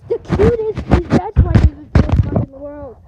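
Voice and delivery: loud, gushing delight; gushing loudly. Real, not acted. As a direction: gush over the cute dog. Gushing about dog.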